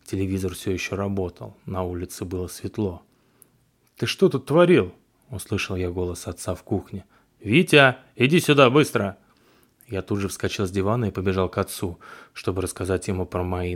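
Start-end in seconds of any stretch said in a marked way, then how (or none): 4.0-4.9 angry father. Acted. Father scolds son. 7.4-9.2 shouting. Acted. Father calls son urgently.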